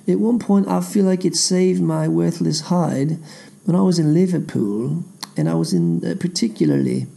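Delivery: ape a British accent as you tell the tale; American doing a British accent. Shown as a demonstration. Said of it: "aping in a, a British accent".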